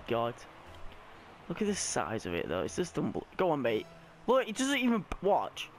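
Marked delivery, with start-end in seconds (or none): none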